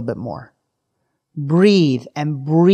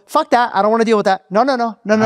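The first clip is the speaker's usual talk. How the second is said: dismissive refusal; 6 semitones higher, faster than usual. Retold or imitated. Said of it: "what we normally do is we go".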